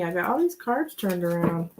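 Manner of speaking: ordinary talk, nothing marked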